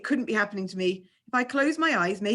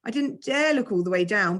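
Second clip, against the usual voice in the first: plain reading voice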